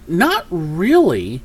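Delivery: ordinary delivery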